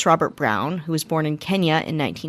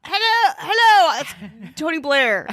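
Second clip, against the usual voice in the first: mock British voice; 9 semitones higher, slower than usual. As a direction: ham up a posh British greeting, high and bright. Mock British greeting as Tony Blair.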